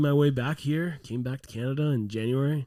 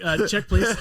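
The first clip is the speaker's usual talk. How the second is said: laughing mock; 6 semitones higher, 5 dB louder, slower than usual. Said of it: "They'd be like".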